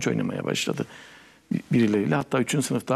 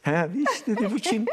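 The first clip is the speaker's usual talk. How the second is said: repeating the aunt's Erzurum dialect with a higher lift on bu kim; playful Erzurum dialect, bu kim pitched up; 9 semitones higher than usual. Retold or imitated. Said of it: "O Erzurum şivesiyle".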